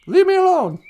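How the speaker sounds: a loud, grumpy brush-off to a nagging friend; grumpy loud refusal. Pretend.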